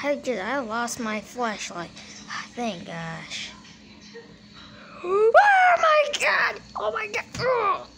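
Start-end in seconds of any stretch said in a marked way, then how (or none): 5.0-7.9 high, loud horrified cry; terrified scream. Acted. Player jump-scared in the woods, high horrified cry. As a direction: shriek in terror.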